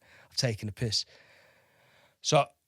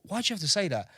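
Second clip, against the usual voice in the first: 1 indignant retort; 8 semitones higher than usual. Retold or imitated.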